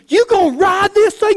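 high, loud call across the chute; buddy at the gate yelling at the rider; impatient shout. Retold or imitated. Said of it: "He goes".

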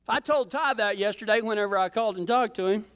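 ordinary talk

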